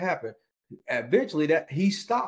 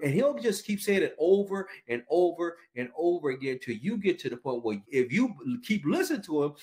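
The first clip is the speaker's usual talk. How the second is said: ordinary talk, nothing marked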